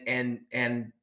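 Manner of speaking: ordinary talk, nothing marked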